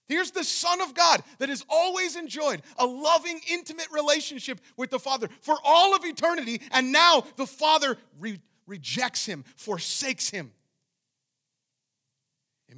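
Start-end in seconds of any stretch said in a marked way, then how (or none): 0.1-10.5 high, very loud sermon delivery; relentless, urgent pulpit shout; impassioned preaching. Real, not acted. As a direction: preach at full force, nearly shouting. Preaches at full force.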